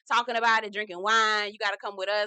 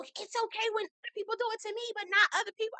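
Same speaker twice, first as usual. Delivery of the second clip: much higher, fast mocking whine; mocking whiny Angie; 11 semitones higher, faster than usual. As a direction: parody her indignant whine. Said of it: "She was like".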